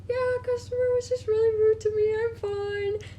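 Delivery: high-pitched, on the verge of crying; small, very high teary voice; re-enacted near-tears. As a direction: squeak it out as if holding back tears. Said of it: "I was about to cry".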